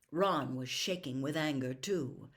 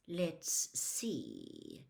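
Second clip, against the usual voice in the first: silky; 6 dB quieter than usual. A narrator's reading. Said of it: "in his silkiest voice".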